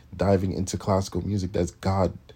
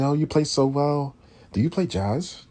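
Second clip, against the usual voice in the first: higher, sugary polite voice; 8 semitones higher, 3 dB louder than usual. Retold or imitated. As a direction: ape the surprised compliment, pitched up. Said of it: "They'd come to me".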